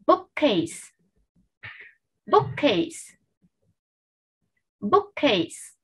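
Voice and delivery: "bookcase" said slowly, with a crisp final K and S; bookcase modelled. Shown as a demonstration. Teacher models "bookcase" for the class to copy, crisp and slow. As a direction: model "bookcase" for the class, every sound clear. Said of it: "vậy mình sẽ đọc từ này là gì".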